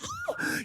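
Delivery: high, quiet mock gasp; panicked gasp. Storyteller reenacting his breakup panic, high distressed gasp.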